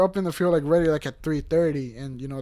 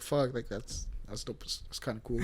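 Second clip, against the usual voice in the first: hushed, awed murmur; quiet; 6 semitones lower, 11 dB quieter than usual. Retold or imitated. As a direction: say it under your breath, impressed. Retold own quiet reaction.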